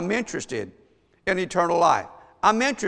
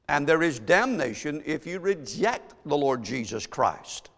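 ordinary talk

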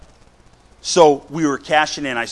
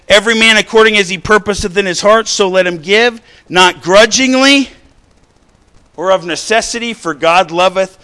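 loud, raised scripture reading; preaching scripture; 6 semitones higher, 9 dB louder than usual. Real, not acted. Preacher reading a Bible verse to the congregation, loud declamation. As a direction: declaim the verse at full force.

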